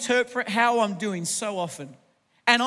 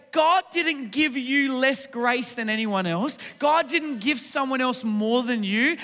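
raised, driving sermon delivery; rousing preaching; 3 semitones higher than usual. Real, not acted. Preacher telling strugglers God gave them no less, rousing preaching. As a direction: preach it out to the whole room with building conviction.

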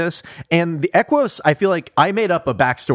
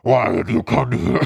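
muffled voice; 2 semitones lower than usual. Retold or imitated. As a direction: speak through a hand over the mouth. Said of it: "it sounds like this".

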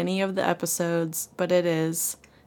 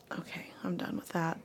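whisper; 11 dB quieter than usual. Real, not acted. Whispered aside after egg joke.

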